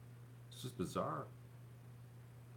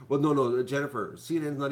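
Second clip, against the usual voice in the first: emphatic; 5 semitones higher, 10 dB louder than usual. Real, not acted. Correcting a chat member, loud.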